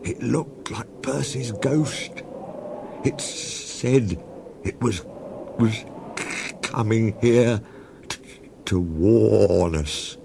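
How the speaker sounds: halting, slow, stammering fright; shaky voice. Acted. Toby warns Thomas about the ghost, shaky and slow. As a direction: stumble over the words, scared. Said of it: "in a shaky voice".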